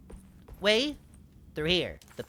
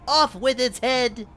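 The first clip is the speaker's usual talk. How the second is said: mock royal command; 11 semitones higher, 12 dB louder than usual.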